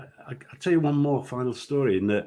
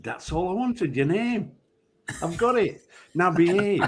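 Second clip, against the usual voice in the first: mock stern referee; 8 semitones higher than usual. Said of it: "I said".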